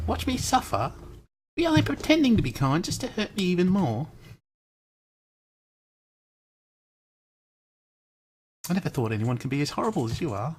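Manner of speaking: a lightly put-on British accent, high and pleading at the start. British accent as the club president pleading with the player. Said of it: "I don't have to do a British accent anymore".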